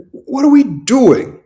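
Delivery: exasperated disbelief. Real, not acted. Exasperated rant about referees.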